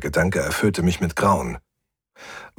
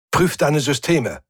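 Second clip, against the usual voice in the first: raised, firm order; commanding; 7 semitones higher than usual. A narrator's reading. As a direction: give an urgent command. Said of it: "befahl ich".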